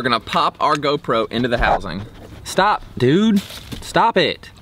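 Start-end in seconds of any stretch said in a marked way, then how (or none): 2.4-4.5 sharp, high scolding call; scolding the dog. Real, not acted. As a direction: tell the dog off.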